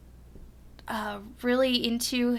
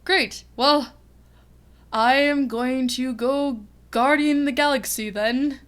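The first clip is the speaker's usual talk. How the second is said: Thor; 3 semitones higher, 5 dB louder than usual. Acted. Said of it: "And Thor's like".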